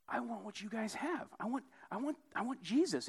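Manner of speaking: high, soft pleading; imitating a hurting man's yearning plea; acted plea. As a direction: beg in a small high voice, building in volume. Acted hurting man begging.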